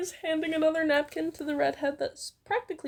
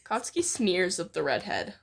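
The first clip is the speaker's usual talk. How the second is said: ordinary talk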